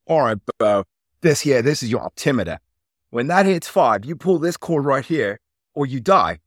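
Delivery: put-on brief the jumper in a hammy Aussie accent; bad Australian accent. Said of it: "again, I'm gonna do the, the bad Australian accent".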